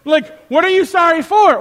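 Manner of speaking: high, loud, incredulous challenge; exasperated acted quarrel; acted indignant retort. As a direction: fire back in mock outrage. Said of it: "I'm getting a little passionate".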